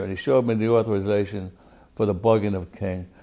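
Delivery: ordinary delivery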